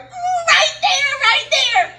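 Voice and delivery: slow, very high, loud mock shriek; panicked shriek. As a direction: squeal it out in acted panic. Pointing out snake in retold panic.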